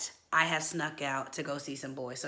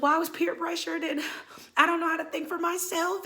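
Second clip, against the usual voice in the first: put-on high, pleading kid voice; whiny excuse; 11 semitones higher than usual. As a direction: whine your way out of trouble. Child whining excuses to mom.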